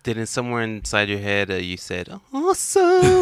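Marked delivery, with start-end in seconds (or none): 2.3-3.2 high, loud sarcastic awesome; mock awesome. Friend teasing his inner voice, high mock awesome. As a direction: squeal it with fake glee.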